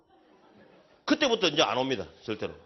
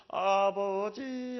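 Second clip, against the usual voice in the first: drawn-out wailing cry, lightly imitated; mock wailing prayer; much slower than usual. Imitating widows praying in the corner, mock wail. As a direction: moan the word like a weeping widow.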